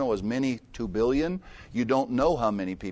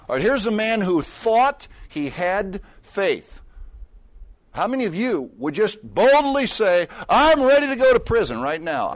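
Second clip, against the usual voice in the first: high, loud full-force preaching; fiery sermon; 10 semitones higher, 9 dB louder than usual. Acted. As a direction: drive the point home to the congregation.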